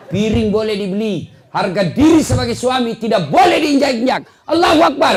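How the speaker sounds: rally the crowd to their feet; rousing shout. Real, not acted. Preacher rallies husbands.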